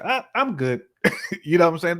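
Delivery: high, breezy "I'm good" with a laugh; dismissive brush-off. Retold or imitated. Brushing off a dull suitor, breezy and dismissive. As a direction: wave them off lightly after five minutes. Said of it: "I'm like".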